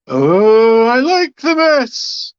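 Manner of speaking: high, wailing ghost voice; hammed-up spooky falsetto with hooting oohs; sighing ghost. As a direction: sigh it out as a put-upon phantom. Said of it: "you hear a voice sigh heavily and say".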